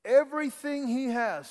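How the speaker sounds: high, slow, ringing pulpit delivery; preacher builds to the key line; preaching. Acted. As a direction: proclaim the scripture to the congregation. Preaching the verse.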